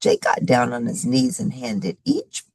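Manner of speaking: plain reading voice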